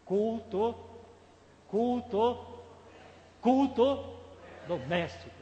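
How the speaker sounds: loud, high, spaced-out chant of a slogan; emphatic calling out. Real, not acted. Preacher drilling the congregation on the answer, emphatic calling out.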